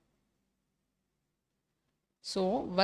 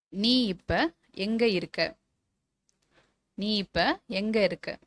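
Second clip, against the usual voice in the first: slow dictation; much slower than usual. Shown as a demonstration. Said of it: "teacher dictates a model sentence".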